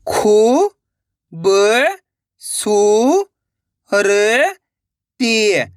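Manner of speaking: each syllable held long, then a pause. Shown as a demonstration. Teacher showing how to read, one syllable at a time. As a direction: break the word into separate stretched syllables. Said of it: "एक एक अक्षर का उच्चारण करके".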